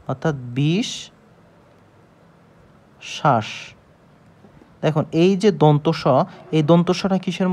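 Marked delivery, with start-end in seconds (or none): 0.6-3.5 "বিশ ... শ্বাস" split by a long pause, sh on both halves; doubled sh sound. Shown as a demonstration. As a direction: hold বিশ rising, pause, drop on শ্বাস. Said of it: "সে দুইবার উচ্চারণ হবে". ("বিশ ... শ্বাস" ভেঙে, শ দুইবার উচ্চারণ)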